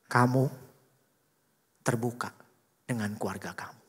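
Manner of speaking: low, measured, spaced-out command; inner voice of God. Acted. God speaks in his heart about family, low and measured. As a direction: say it low, pausing between phrases. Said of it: "ada suara di hati saya".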